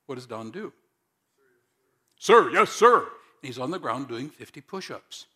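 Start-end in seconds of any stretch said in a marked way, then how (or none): 2.2-3.0 loud barked reply to sergeant; shouting. Pretend. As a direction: shout it like a recruit. Recruit answers drill sergeant.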